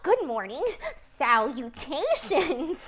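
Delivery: overjoyed pig greeting; eager pig greeting. Acted. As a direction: greet her with bursting joy.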